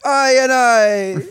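high, loud, slow Jamaican voice; Rasta voice. Pretend. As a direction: stretch it out like a Rasta. Rastafarian dubbing voice.

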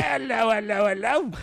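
high, shouted mock Cockney; Cockney accent. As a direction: boom it out in comic Cockney. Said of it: "He's got a Cockney accent".

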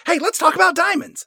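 high, folksy American salesman voice; Colonel Moon. Retold or imitated. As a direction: gush like a hammed-up American huckster. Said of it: "Moon says".

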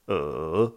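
E dipping down then rising, loud; "E" in a V-shaped contour; third tone. Shown as a demonstration. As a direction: dip "E" low and climb longer. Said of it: "Avec le son E".

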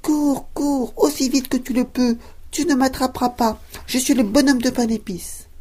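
cheeky cookie shouting back over his shoulder; gingerbread man taunting. Acted. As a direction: tease them from a safe distance, high and bright. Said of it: "en criant".

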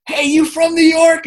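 high, loud, drawn-out call; excited stranger. Retold or imitated. Strangers stopping him in the mall, loud excited call. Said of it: "they would stop me, and they'd go".

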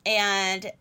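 ordinary talk

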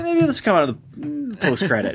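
high, fast, goofy retake; silly high voice. Pretend. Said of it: "let's start this one all the way over".

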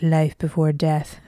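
slow, lightly voiced solemn narrator impression; Morgan Freeman. Inner voice speaking to a warrior mid-battle, slow Morgan Freeman impression. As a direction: intone it slowly, like a wise inner voice. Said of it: "it's like Morgan Freeman's voice".

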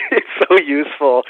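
high, loud, laughing reply; laughing agreement. Real, not acted. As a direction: play along with the joke, laughing. Laughs along at interviewer's joke.

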